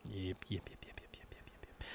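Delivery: breathy whisper, fading away; whispered yeps. Real, not acted. Agreeing with the co-host, trailing off into a whisper.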